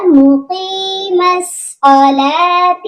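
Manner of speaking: مقیم chanted with a fatha on the meem, ma-qeem; correct recitation. Shown as a demonstration. Teacher shows students the correct vowel, chanted. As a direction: sing مقیم with an open a on the meem. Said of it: "یہ پڑھا جائے گا".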